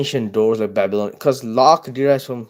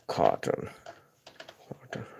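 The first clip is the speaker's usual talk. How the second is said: hushed whisper; whispered word; 8 semitones lower, 13 dB quieter than usual. Real, not acted. Typing a search, whispering the word.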